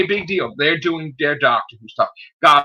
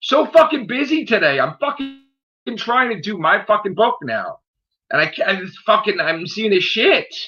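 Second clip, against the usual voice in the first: higher-pitched, fed-up venting; real exasperation; 6 semitones higher than usual. Real, not acted. Venting to viewers about being interrupted while busy, high exasperated complaint. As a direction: complain in a raised pitch, at wit's end.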